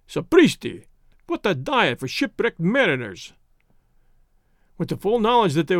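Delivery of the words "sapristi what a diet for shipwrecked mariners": mildly exclaimed protest; cried out. A narrator's reading. Frenchman protesting the castaways' diet, mild outcry. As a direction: exclaim at the news in a lightly put-on voice. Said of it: "cried Monsieur Thuran".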